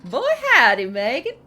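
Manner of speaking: loud, high Texan greeting; Texas accent. Shown as a demonstration. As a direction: belt it out in a hammed-up Texas accent. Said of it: "I can do a better Texas accent".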